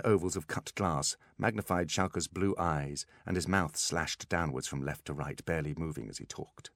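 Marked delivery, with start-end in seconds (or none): none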